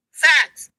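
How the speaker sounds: FACTS punched high and loud; emphatic insistence. Real, not acted. Woman insisting she brought the audience facts, FACTS punched emphatically.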